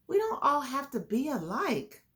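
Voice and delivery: usual speaking voice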